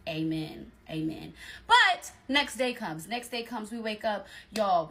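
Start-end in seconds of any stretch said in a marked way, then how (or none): 0.1-1.3 low, soft relieved amen. Real, not acted. Relieved mock-praise. 1.7-3.1 slow, loud dramatic buildup. Real, not acted.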